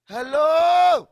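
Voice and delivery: loud, high call to the crowd. Real, not acted. Preacher shouting hello at the congregation. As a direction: wake the room up.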